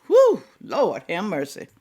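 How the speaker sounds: usual speaking voice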